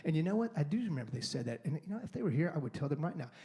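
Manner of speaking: usual speaking voice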